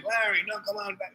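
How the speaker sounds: high, soft mock yell; imitated calling out. Kids call Larry back from the steamroller, high mock yell. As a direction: call a warning as if from afar, held down in volume.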